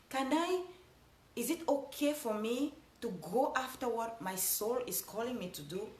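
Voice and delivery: usual speaking voice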